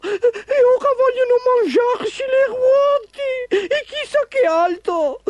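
very high, tearful little-car voice; distressed little car. Acted. Little car telling Ford of the kidnapping, tearful squeal.

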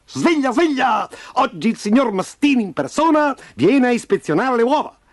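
high, strident wake-up call; shrill crowing. A narrator's reading. Rooster waking hens for boss's inspection, shrill. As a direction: crow it out like a rooster. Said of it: "striduli chicchirichì di Von Crest".

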